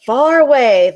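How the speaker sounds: usual speaking voice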